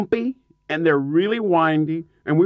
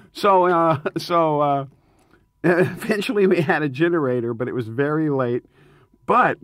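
normal talking voice

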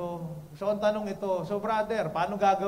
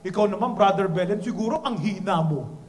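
louder mock jab from an auntie; teasing auntie; 2 semitones lower, 5 dB louder than usual. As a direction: poke fun at the preacher.

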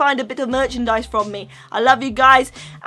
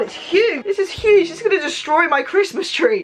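amazed excitement; 5 semitones higher than usual. Real, not acted. Amazed by gift's size.

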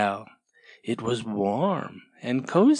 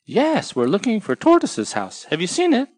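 much higher eager tortoise voice; Tortoise answering; 9 semitones higher, 4 dB louder than usual. Acted. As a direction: ask hopefully.

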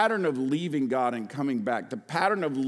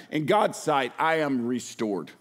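ordinary talk, nothing marked

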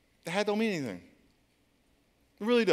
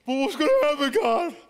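high, louder worried cry; anxious plea; 10 semitones higher, 5 dB louder than usual. Retold or imitated. Player asks God about his future, anxious cry. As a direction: beg God for answers. Desperate prayer.

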